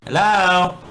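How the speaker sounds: high, loud rising hello; calling for attention. Acted. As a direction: snap him out of it. She waves her hand.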